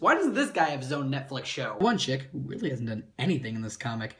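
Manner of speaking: ordinary talk, nothing marked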